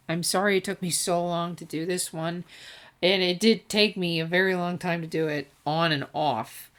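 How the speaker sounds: ordinary delivery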